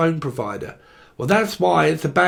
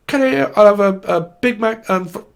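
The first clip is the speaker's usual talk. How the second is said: louder, raised mock ordering voice; breaking-up customer; 5 semitones higher, 5 dB louder than usual. Pretend customer ordering a Big Mac, louder and broken up. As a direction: push the order out, then cut off. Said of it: "going up to a McDonald's".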